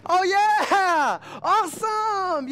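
high, loud, drawn-out awesome; excited worshipper. Retold or imitated. Preacher showing how praise should sound, high and thrilled. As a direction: gush it with slow delight. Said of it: "supposed to be".

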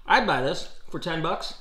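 talking while chewing; speaking around food; mouth full of steak. Real, not acted. Seen 1: Fork goes to his mouth; he chews while saying the words.

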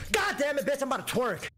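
high startled yelp, then a joke. Real, not acted. Player startled by a jump scare, high yelp. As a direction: jolt up in pitch like you got spooked.

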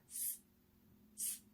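스 as a pure hissed s, no vowel; voiceless S sound. Shown as a demonstration. Teacher inviting learners to try the sound, voiceless hissed 스. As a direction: hiss 스 with tongue near the gum ridge. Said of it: "자, 한번 해볼까요?".

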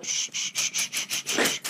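rapid string of shushes; sharp repeated shh; urgent shushing. Acted. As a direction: hush yourself repeatedly. Hushing herself at handsome man.

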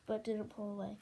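usual speaking voice

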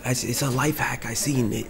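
ordinary talk, nothing marked

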